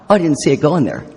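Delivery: normal talking voice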